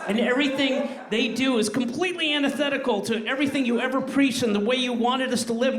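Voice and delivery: a parent's growing fury at the fans; rising outrage. Acted. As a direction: let the indignation swell toward the last words.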